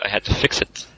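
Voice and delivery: usual speaking voice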